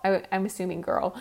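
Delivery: ordinary delivery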